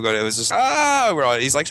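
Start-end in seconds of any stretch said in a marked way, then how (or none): 0.5-1.1 high-pitched mock cry of alarm; retold panicked yelp. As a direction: yelp "Ah!" as the mess spills. Story of kid vomiting through bag.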